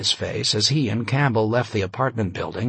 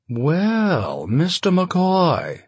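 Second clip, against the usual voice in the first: drawn-out mid-Atlantic greeting; slow, lofty mid-Atlantic accent; 6 semitones higher, much slower than usual. Acted. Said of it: "with a mid-Atlantic accent".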